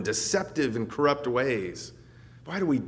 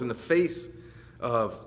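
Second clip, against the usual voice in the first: ordinary delivery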